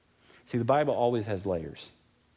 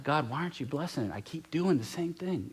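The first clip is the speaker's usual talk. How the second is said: higher-pitched imitation of a whiny plea; mock complaint; 7 semitones higher than usual. Believer asking God why he isn't blessing, mock complaint. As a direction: plead upward, imitating a puzzled believer.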